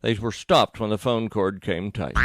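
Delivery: ordinary delivery, nothing marked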